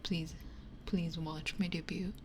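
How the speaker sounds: ordinary delivery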